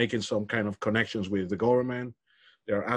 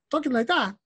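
Galician sing-song lilt, pitched much higher; 14 semitones higher than usual. Retold or imitated. Guest showing how people talk in Galicia, Galician lilt. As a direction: imitate a Galician speaker's lilting tune. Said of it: "the way we talk in Galicia".

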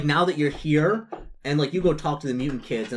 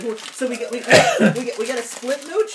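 higher voice, laughing through it; high NPC voice; 11 semitones higher, 7 dB louder than usual. Acted. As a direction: play her giddy and high. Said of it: "she's like".

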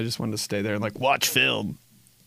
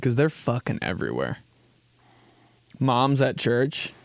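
usual speaking voice

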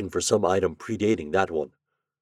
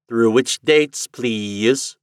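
a chirpy, metallic library automaton, louder; cold metallic machine voice; 4 semitones higher, 6 dB louder, much slower than usual. A narrator's reading. Library automatic asks Shandor for his reference, cold and metallic. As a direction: speak like a cold machine clerk. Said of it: "cold metallic voice".

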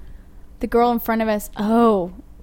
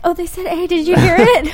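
high and louder, thrilled; excited, high; 8 semitones higher, 8 dB louder than usual. Retold or imitated. Telling her husband she heard eh, high excited gush. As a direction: gush it out like a delighted tourist.

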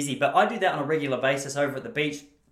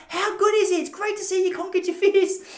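very high, louder and gushing; delighted old lady; 6 dB louder than usual. Retold or imitated. Old lady praising him for conquering his fears, high and gushing. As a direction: gush like a proud old lady. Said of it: "She goes".

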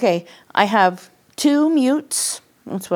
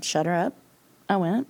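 ordinary talk

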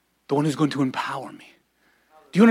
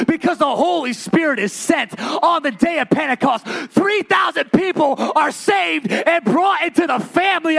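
rising, shouted preaching cadence; full-force preaching; 8 semitones higher, 4 dB louder than usual. Acted. Preacher shouting to the congregation about greater things. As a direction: preach it out loud to the church.

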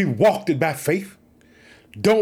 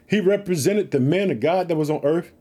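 normal talking voice

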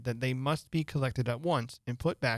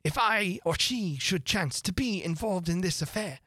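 higher rabbit voice in measured lines; reciting verse; 6 semitones higher than usual. A narrator's reading. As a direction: recite the poem in a high, fussy voice.

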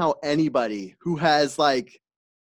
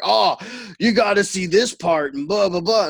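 put-on drunk guy; 6 semitones higher, 3 dB louder than usual. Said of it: "He's like".

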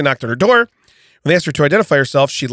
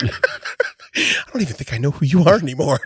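ordinary talk

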